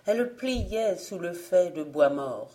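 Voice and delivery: ordinary delivery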